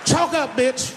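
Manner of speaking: barked orders at a dancer, rising high; pimp-style shouted orders; bossy yell. Pretend. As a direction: yell it like a strip club boss. Pimp yelling at stripper.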